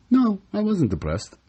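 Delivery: ordinary delivery, nothing marked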